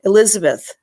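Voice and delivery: ordinary talk, nothing marked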